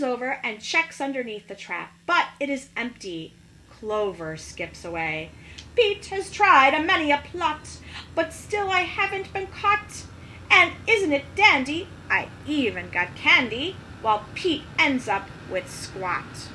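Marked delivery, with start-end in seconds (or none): 5.7-16.5 rhyme. Acted. Leprechaun recites a rhyming poem.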